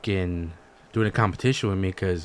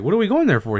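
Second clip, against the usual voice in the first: high, louder incredulous question; retold shocked question; 10 semitones higher, 5 dB louder than usual. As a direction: act out being caught off guard, high and loud. Retold quarrel with dad.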